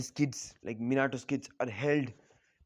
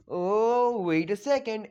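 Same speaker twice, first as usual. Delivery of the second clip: high, louder exclamation with a stretched oh; mock sudden idea; 6 semitones higher, 5 dB louder, slower than usual. Menma and Mito join the beating, mock-excited interjection. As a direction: pipe up as if struck by an idea.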